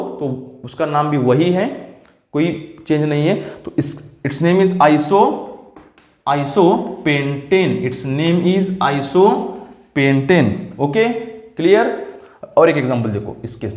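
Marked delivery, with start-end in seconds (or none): 4.2-10.5 very slow, word by word; slow dictation. Real, not acted. Teacher writing a compound name for students, slow dictation. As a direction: say it slowly as you write it out.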